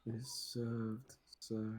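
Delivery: slow, soft, eerie servant voice; creepy butler. Acted. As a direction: hush it out like a ghostly maid. Maid repeats greeting, slow and soft.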